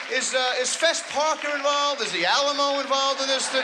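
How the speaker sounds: a confused theatregoer pipes up; clueless audience member. Retold or imitated. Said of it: "I started raising my hand".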